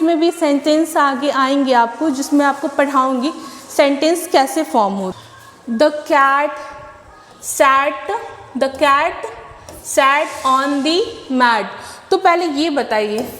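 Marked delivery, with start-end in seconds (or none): 5.7-11.7 very slow, pausing after each word; slow classroom rhyme reading. Shown as a demonstration. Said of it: "rhyme read slowly".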